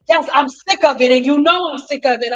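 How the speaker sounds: fed-up yelling, acted out; angry outburst. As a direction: imitate a client snapping at full volume. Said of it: "every time she had an outburst".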